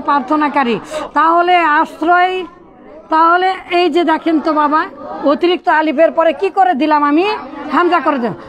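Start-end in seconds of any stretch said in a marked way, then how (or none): none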